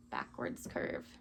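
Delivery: "backwards curve" in a low, breathy whisper. Shown as a demonstration. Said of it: "tracing the stroke aloud".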